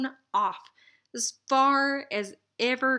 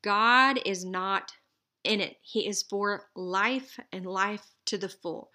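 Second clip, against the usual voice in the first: usual speaking voice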